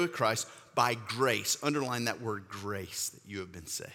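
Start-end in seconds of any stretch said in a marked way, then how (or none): 1.6-3.9 hushed aside. Acted. Soft instruction after preaching.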